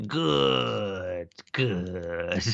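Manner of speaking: lightly voiced drawn-out Sith "good"; Palpatine good good. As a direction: purr it slowly like the Emperor. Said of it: "it went a bit Palpatine there".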